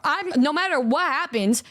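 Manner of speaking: ordinary talk, nothing marked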